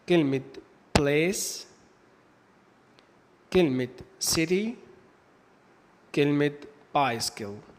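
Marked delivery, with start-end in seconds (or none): 1.0-1.7 "place" ending in a clear S sound; C pronounced as S. Shown as a demonstration. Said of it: "حرف السي بينطق حرف الاس". 4.2-4.7 "city" starting with an S sound; C pronounced as S. Shown as a demonstration. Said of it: "حرف السي بينطق حرف الاس". 7.0-7.7 "bicycle" with an S sound in the middle; C pronounced as S. Shown as a demonstration. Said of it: "حرف السي بينطق حرف الاس".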